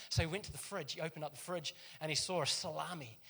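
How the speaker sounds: ordinary talk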